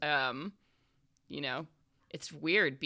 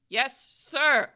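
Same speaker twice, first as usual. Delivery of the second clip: high, careful, drawn-out reply; rookie suppressing stutter; 8 semitones higher, 5 dB louder than usual. Acted. Scolded rookie answers his boss, high and careful. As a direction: force it out without stammering. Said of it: "Stop stuttering".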